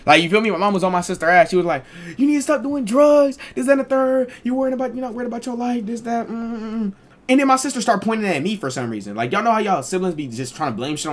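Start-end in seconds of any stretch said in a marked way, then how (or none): 2.2-6.9 scolding mom. Retold or imitated.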